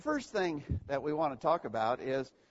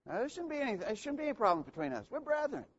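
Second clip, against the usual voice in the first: high pleading emphasis; 9 semitones higher, faster than usual. Acted. Pleading for peace among brethren.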